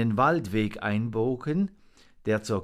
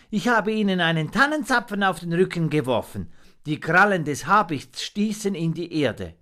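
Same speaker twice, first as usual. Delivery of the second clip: high, louder creature voice; little monkey, eager; 8 semitones higher, 5 dB louder, faster than usual. A narrator's reading. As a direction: report excitedly in a raised voice.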